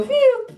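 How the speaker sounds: very high cartoon "pew"; rising whistle noise. Comedian imitating a boner springing up, high swoop. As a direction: shoot out a high swooping sound effect. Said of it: "His boner would go".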